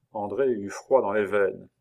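ordinary delivery, nothing marked

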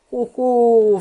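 a touch of acted mockery; slightly mocking. A narrator's reading. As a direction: poke fun with a drawn-out exclamation. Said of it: "fit Anne d'un ton un peu moqueur".

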